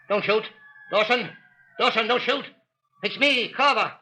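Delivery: panicked shout to Dawson; urgent shout. Acted. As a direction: call out before he fires. Carver shouting to armed foreman.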